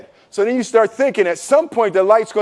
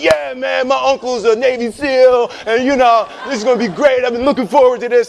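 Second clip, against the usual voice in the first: fake bravado; 5 semitones higher than usual. Friends bragging, pretending calm.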